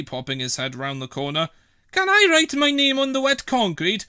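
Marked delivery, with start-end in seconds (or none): 1.9-4.0 put-on high, louder naughty-boy voice; a kid angling for mischief; cheeky boy. Norman asking Trevor about the wet concrete, cheeky.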